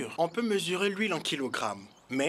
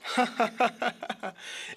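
merchant's amused chuckle; 6 semitones higher than usual. Acted. Merchant laughs at question.

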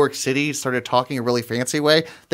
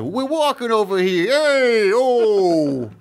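higher, hammed-up New York street accent, loud on Hey; 7 semitones higher, 3 dB louder, much slower than usual. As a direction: play a brash New Yorker calling out. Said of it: "like New York".

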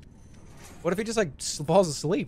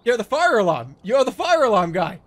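loud and raised; shouting over fire alarm; 5 semitones higher, 8 dB louder than usual. Real, not acted.

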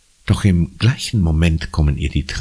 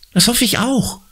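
put-on high girl voice, hissed in spite. A narrator's reading. Conny hisses at Marco about the paint stains. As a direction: spit it out through the teeth. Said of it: "zischt Conny".

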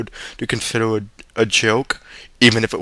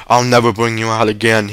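ordinary delivery

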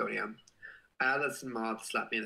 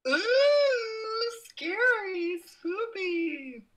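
high, hammed-up spooky voice; mock spooky; 4 dB louder, much slower than usual. Streamer mocking the 666 like count, hammed-up spooky. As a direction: play up a fake scare. Reads 666 likes comment.

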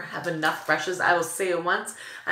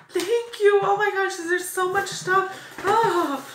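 very high, gushing squeal; overwhelmed thanks; 13 semitones higher, 4 dB louder, slower than usual. Real, not acted. Vlogger thanking the sender for so much stuff, overwhelmed. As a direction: squeal your thanks, stretching it out at the end.

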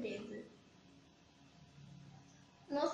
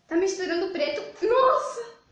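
laughing loud and high; 6 semitones higher, 9 dB louder than usual. Real, not acted. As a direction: crack up while talking. Laughing while making a mess.

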